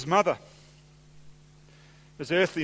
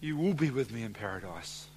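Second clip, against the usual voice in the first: low, soft and reverent; quiet scripture quote, voice dropped; hushed solemn quote; 5 semitones lower, 8 dB quieter than usual. Retold or imitated. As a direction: lower the voice for a grave promise. Preacher quotes Jesus' assurance solemnly.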